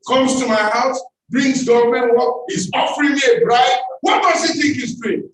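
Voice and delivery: high, loud indignant yelling; furious rant. Retold or imitated. Mother telling her son about the bribe, outraged high shouting. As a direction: rage at the insult of a bribe.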